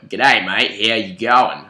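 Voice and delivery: loud hammed-up Australian accent; put-on Australian accent. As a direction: lay the Aussie accent on thick and loud. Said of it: "But that is so put on".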